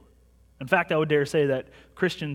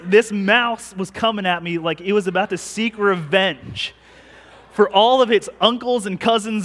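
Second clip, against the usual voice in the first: excited retelling; 3 semitones higher, 7 dB louder than usual. Retold or imitated. Excited mouse attack story.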